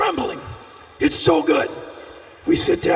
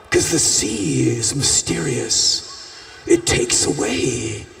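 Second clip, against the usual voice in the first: mock mystical; 11 semitones lower than usual. Inventing a mystical line about sea.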